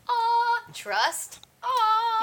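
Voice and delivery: high, sappy sighing "aw"; mock dreamy. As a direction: coo it like a lovesick fool. Mocking a romantic ideal.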